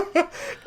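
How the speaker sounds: short high giggle; high laugh. Real, not acted. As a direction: burst into a little laugh. Laughs at own accent.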